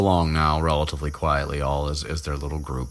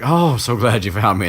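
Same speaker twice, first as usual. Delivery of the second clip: lightly hammed-up, higher, louder relief; mock relief; 6 semitones higher, 6 dB louder than usual. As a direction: gush with imitated gratitude. Neville relieved at being found.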